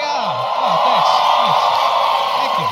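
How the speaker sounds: loud, gushing fake gratitude; mock overjoyed thanks. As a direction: overplay the flattered star. Fan flatters him, mock gratitude.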